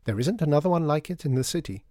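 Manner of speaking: high-voiced proud shopkeeper; insistent boasting. Shopkeeper praising the store to a visitor, insistent. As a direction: press the sales pitch.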